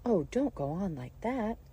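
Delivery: high, distressed plea; cried in despair. A narrator's reading. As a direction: beg her to stop, flustered. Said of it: "cried the poor Queen".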